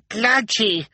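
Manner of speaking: very high, loud little yelp; child's cry. A narrator's reading. Sonny cries out amid the angry crowd, high yelp. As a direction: pipe up in alarm. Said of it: "Sonny cried".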